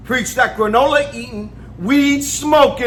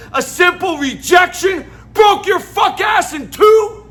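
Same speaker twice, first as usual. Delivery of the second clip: high, raised voice of disbelief; incredulous scolding; 7 semitones higher than usual. Retold or imitated. Club worker confronting crying Joe over his breakdown, incredulous scolding. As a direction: lay into him, pitch jumping up.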